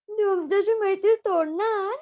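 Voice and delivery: high, loud worried bee voice; a bee afraid of losing its friend, high and loud; surprised bee. Acted. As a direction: squeak it out as a startled little bee.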